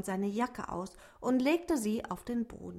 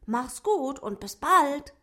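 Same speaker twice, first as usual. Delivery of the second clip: higher, quick little dwarf voice; dwarf saying goodbye; 6 semitones higher, 7 dB louder than usual. A narrator's reading. Dwarf Otto says goodbye to the rabbit, higher and quick. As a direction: hurry through the farewell. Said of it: "flüsterte er zum Abschied".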